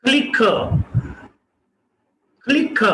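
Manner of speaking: क्लिक with puff on k; aspirated k. Shown as a demonstration. Teacher models क्लिक aspirated. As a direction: say क्लिक as khlik. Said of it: "next example".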